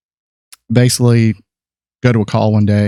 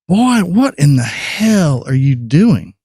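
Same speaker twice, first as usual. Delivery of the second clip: a higher, slow put-on Texan drawl, scolding; gruff Texan; 5 semitones higher, much slower than usual. Darkroom old-timer catching a kid fumbling, in a slow Texan scold. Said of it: "The guy's yelling at me".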